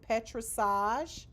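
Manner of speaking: petrissage said very slowly as PET-ri-SAHZH, rising; pronunciation demo. Shown as a demonstration. Teacher showing students how to say petrissage, very slow. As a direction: stretch out petrissage syllable by syllable. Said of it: "That's how you pronounce it".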